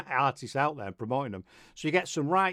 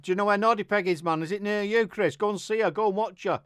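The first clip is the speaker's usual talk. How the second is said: ordinary talk, nothing marked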